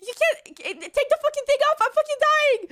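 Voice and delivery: high, loud frantic demand; retold panic. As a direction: act out desperate panic.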